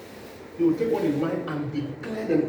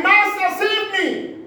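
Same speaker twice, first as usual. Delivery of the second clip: high, loud plea to be saved; desperate scream of Peter; panicked cry for help; 13 semitones higher, 5 dB louder, slower than usual. Retold or imitated. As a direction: cry out like a sinking man. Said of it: "And Peter screamed".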